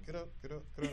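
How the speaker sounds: quiet, under the breath get up; willing him up silently; inner urging. Retold or imitated. Father silently urging his fallen son to rise, hushed. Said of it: "I'm saying, in my mind".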